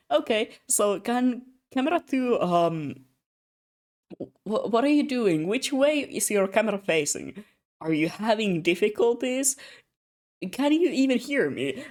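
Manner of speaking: high, loud, rapid-fire questions into the mic; frantic director. Retold or imitated. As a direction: sound like the shoot is falling apart. Frantic director calling to camera crew.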